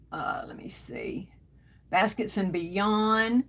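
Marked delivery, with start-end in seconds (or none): 0.1-1.3 under her breath, almost no voice; whisper. Real, not acted. Host scanning her livestream comments, whispered. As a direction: breathe it to yourself while searching the comments.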